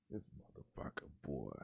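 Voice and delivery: breathy whispered insult; whispered curse. Real, not acted. Vlogger whispering a curse at Ted Cruz, disgusted. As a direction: hiss it under your breath.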